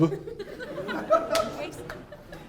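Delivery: high, unvoiced burst of laughter; high breathy laugh. Real, not acted. Speaker laughing at the mention of Jeb. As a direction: laugh it out at the name.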